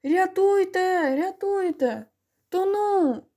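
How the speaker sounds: high, drawn-out drowning cries; bawling for help. A narrator's reading. Drowning figure calls to boys on shore, bawling. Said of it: "людським голосом загорлала".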